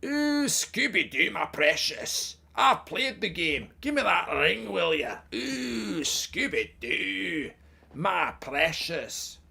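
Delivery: high, slow, drawn-out creature voice; slow, high Scottish caricature; Gollum, Scottish parody. Acted. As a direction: stretch each phrase out in a mock Scottish creature voice. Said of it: "can you do a Gollum impersonation".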